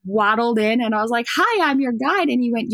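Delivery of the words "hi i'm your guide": higher, louder chirpy hello; chirpy greeting. Retold or imitated. Pregnant guide introducing herself to a client, chirpy. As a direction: greet them brightly in a raised voice.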